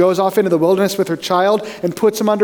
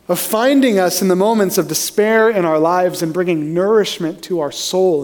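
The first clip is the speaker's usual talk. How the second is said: usual speaking voice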